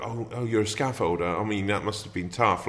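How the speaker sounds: patronising pity, a touch hammed; posh middle-class mock. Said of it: "a middle-class attitude that".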